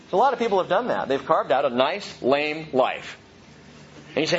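ordinary talk, nothing marked

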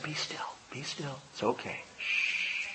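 put-on whispered be still to an upset child; very soft comforting whisper; soothing whisper. Shown as a demonstration. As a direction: hush a child on your lap. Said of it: "held a child on your lap".

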